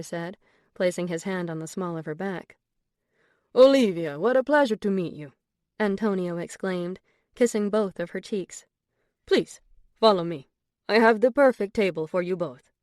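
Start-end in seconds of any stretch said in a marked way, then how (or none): 3.5-5.3 put-on louder, delighted Italian accent. A narrator's reading. Antonio greets Olivia. 9.3-12.7 put-on louder, delighted Italian accent. A narrator's reading. Antonio leads them to table.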